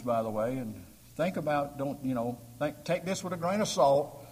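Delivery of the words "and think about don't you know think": normal talking voice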